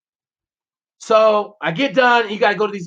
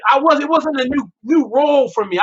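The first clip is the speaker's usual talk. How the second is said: usual speaking voice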